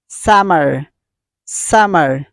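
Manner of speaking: "summer" said carefully with a final American R; model pronunciation. Shown as a demonstration. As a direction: demonstrate "summer" clearly, falling on the R. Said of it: "You should pronounce".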